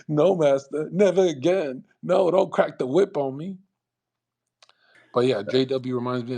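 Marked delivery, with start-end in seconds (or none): 0.1-3.6 higher-pitched imitation of begging; mock pleading slave. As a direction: plead with the master in an exaggerated imitation. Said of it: "And he'd be like".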